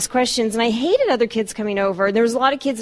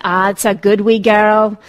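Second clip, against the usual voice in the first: slow, louder Glasgow mum praising; Scottish praise; 6 dB louder, slower than usual. Retold or imitated. Mother praising her little girl, in a Scottish accent. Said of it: "thick Scottish accent".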